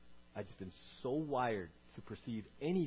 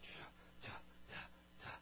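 whispered clicking tsk sounds; tongue click; 11 dB quieter, slower than usual. Retold or imitated. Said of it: "I'm like".

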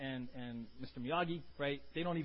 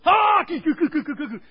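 high, loud mock karate shouts; hammed-up martial arts yells; karate cries; 12 semitones higher, 19 dB louder than usual. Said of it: "And Mister Miyagi goes".

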